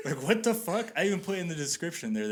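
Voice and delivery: high, indignant protest; retold exasperation. Retold outrage at buyer backing out.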